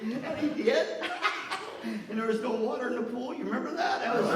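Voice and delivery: can't keep the laugh down. Real, not acted. Telling the pool prank to the room, laughing.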